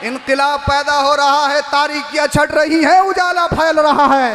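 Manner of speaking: high, loud proclaiming; preaching at full force. Acted. Preacher proclaiming the Prophet's coming to listeners, full-force preaching. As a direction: lift the crowd with every phrase. Climax of sermon.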